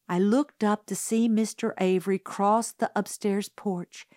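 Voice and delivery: ordinary delivery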